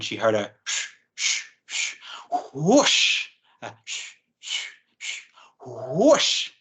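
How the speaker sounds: hushed sh-sh-sh building to a breathy whoosh; whooshing sound effect. A narrator's reading. Storyteller voicing the orangutan's whoosh for children, breathy. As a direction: imitate something swinging closer through the trees.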